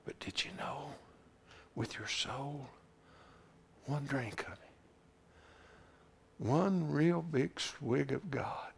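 soft, breathy near-whisper, slowing at the end; hushed preaching. Acted. Preacher leaning in to his flock, hushed whisper. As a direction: draw them in close with a hush.

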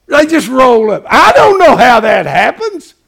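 fiery preacher cry; shouted preaching. Acted. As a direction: proclaim it at full voice.

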